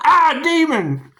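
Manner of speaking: loud, high, drawn-out mock scream; mock demon scare. Reader jokes about a triggered demon, mock scare. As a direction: cry out in hammed-up horror.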